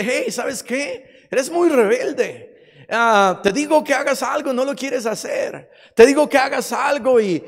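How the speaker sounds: acted divine scolding; Jesus rebuking. Voices Jesus rebuking the listener.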